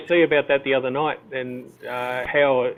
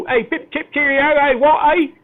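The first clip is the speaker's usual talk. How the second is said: much higher, hammed-up fifties British accent; old-fashioned Pommy accent; 12 semitones higher, 5 dB louder than usual. Aussie guest mocking how Aussies do the British accent. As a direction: toff it up like an old British film. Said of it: "when we do the Pommy accent".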